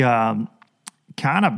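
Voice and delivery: ordinary delivery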